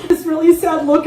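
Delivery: normal talking voice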